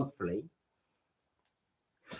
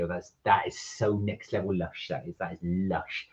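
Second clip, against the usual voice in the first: put-on West Country accent; 9 semitones lower, 7 dB quieter than usual. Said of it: "you've gotta say it in a West Country accent".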